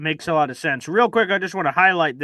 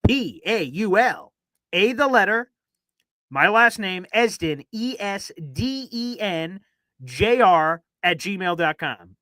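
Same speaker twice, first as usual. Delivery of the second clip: slow, letter by letter spelling; spelled out slowly; slower than usual. Shown as a demonstration. Host spelling his email to viewers, slow and deliberate. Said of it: "Gotta say it real slow".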